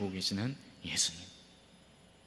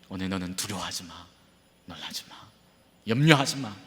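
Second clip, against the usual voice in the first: much softer, near-whispered comfort; tender hush; 5 semitones lower, 6 dB quieter than usual. Acted. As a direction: soothe fearful listeners gently. Intimate whispered reassurance. (속삭이듯 다정하게)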